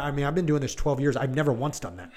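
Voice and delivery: ordinary talk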